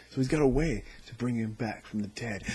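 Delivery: normal talking voice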